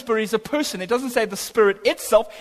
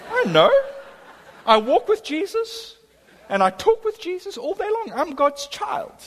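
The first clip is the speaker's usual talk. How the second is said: high-pitched, unhurried woman's voice; voiced Beatrice; 9 semitones higher than usual. Beatrice answers that she is God's child, assuredly. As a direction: answer high and simply, sure of it.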